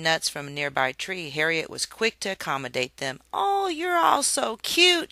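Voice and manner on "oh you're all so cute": high, slow gushing coo. Acted. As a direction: melt over the cute squirrels. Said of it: "she gushed".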